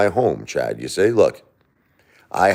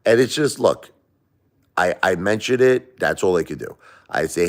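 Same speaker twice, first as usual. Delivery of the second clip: usual speaking voice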